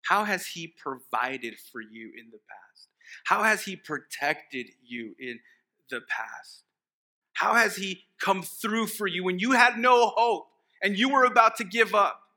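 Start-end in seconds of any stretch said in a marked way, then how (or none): 3.3-12.2 high, loud fervent preaching; preaching at full force. Real, not acted. Pastor urging the church to remember God's help, fervent. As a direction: lift the church up with it.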